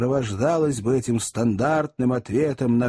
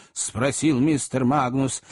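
ordinary delivery, nothing marked

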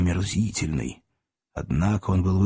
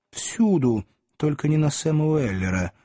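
ordinary delivery, nothing marked

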